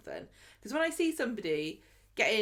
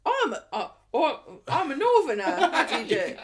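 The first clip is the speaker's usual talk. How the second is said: high, loud laughing northern parody; northern accent; 6 semitones higher, 9 dB louder than usual. Retold or imitated. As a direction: ham up a northerner voice. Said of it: "shots fired at EastEnders".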